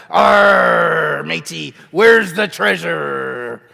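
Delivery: shouted, drawn-out pirate call. Shown as a demonstration. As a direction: bellow it like an over-the-top pirate. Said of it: "This is what the kids like".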